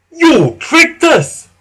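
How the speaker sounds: slow, very high, loud outrage; indignant little-engine shout; tooted accusation. A narrator's reading. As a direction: hurl the accusation, stretching each word. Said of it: "tooted Skarloey".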